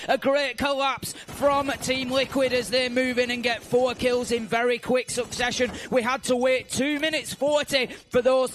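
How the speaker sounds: loud, rising play-by-play call; excited commentary. Real, not acted. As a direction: call the kills with building excitement. Play-by-play call of a decisive push.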